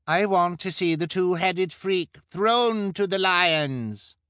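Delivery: high, loud, drawn-out demand; eager cry. A narrator's reading. Bloodthirsty spectator demanding a show, high drawn-out cry. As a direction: cry it slowly at full pitch. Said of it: "cried the woman with dyed hair".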